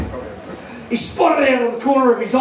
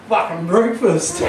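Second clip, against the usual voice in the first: snake voice; 4 semitones lower, slower than usual. Retold or imitated. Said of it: "this snake sticks his head out".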